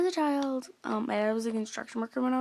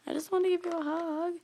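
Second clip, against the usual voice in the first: put-on hurt child; 7 semitones higher than usual. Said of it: "I was like".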